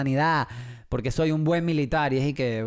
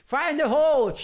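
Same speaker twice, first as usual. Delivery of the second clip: game shout; 12 semitones higher, 4 dB louder than usual. Retold or imitated. Jugando Call of Duty.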